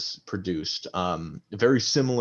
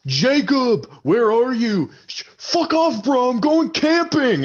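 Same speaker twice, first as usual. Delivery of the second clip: put-on high, loud drunken yell after Jacob; drunk friend yelling; 6 dB louder, slower than usual. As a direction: bellow at your buddy like a wasted camper. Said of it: "you hear a second voice".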